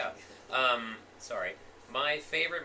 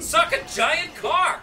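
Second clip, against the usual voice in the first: high, loud, slow laughing taunt; hyped taunting yell; 12 dB louder, much slower than usual. Acted. Gamer taunting his friends in a loud cackling shout.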